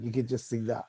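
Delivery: normal talking voice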